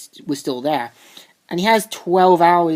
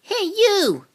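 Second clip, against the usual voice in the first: calling out. Retold or imitated. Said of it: "he sees Randall saying".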